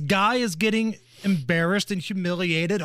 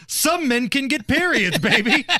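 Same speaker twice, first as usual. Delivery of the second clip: groovy spy swagger, higher pitch; Austin Powers voice; 6 semitones higher, 4 dB louder than usual. Retold or imitated. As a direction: purr it like a swinging sixties spy. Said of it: "it's a picture of Austin Powers".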